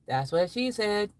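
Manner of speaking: normal talking voice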